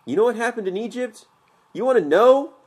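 on the edge of panic, voice breaking upward; hysterical. A narrator's reading. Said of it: "My voice cracked. I was getting hysterical".

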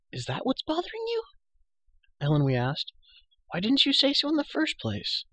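put-on very high, unimpressed girl's question; high girlish voice. A narrator's reading. Girl to prisoner who cannot see, high girlish puzzlement. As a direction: ask it lightly, pitched far up.

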